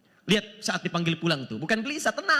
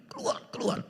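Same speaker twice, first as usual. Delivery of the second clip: hissed command; 4 dB quieter than usual. Retold or imitated. Angels ordering the soul out.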